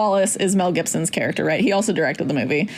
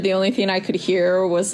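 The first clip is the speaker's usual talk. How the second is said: ordinary delivery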